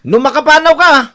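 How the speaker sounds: loud, high threatening shout; menacing threat. Acted. Husband loudly threatening his wife if she leaves. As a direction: warn her with menace.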